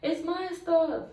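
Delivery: high, slow, whiny cry; tearful protest. Retold or imitated. Refusing the sergeant, crying and mad, pitched high. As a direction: wail it like a hurt child. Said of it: "I'm crying. I'm mad".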